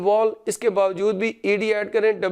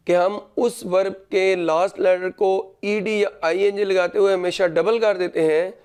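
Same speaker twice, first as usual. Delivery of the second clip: ordinary talk, nothing marked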